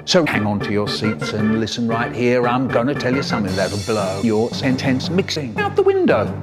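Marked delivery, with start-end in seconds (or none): none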